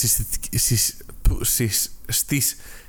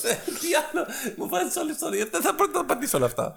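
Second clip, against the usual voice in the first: much higher, softer, laughing complaint; laughing high-pitched protest; 12 semitones higher, 6 dB quieter than usual. Real, not acted. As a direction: squeak out the complaint through laughter. Laughing protest at too many terms. (ψιλή, γελαστή διαμαρτυρία προς τον Κώστα)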